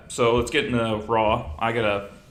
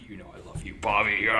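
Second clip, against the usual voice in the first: tender Vince; faster than usual. Retold or imitated. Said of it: "It's like".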